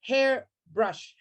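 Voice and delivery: HAIRBRUSH in two slow beats, HAIR, pause, BRUSH; split into syllables. Shown as a demonstration. Teacher counting syllables for the class, splitting hairbrush in two. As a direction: separate "hair" from "brush" with a short gap. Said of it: "has two syllables".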